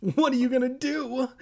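high, squealed panicky question; acted shriek, well above normal. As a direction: shriek it in a held high pitch. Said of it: "Evan shrieked".

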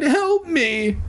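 very high, hammed-up plea; crying for help. A narrator's reading. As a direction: squeal it out in mock panic. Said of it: "I cried".

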